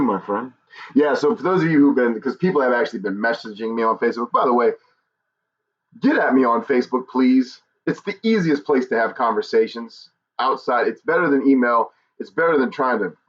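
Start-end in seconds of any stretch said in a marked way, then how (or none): none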